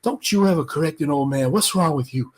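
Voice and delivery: hushed scolding in a corner; Ali. Retold or imitated. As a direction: take him aside and reprove him. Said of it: "I could overhear him say this".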